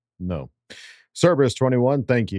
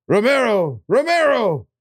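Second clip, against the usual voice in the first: hype announcer; 13 semitones higher than usual. Acted. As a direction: build the name up like an intro. Hyping a fan's name.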